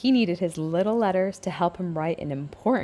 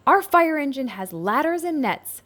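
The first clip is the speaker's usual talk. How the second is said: shouting; 7 semitones higher than usual. A narrator's reading. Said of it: "Little N shouted".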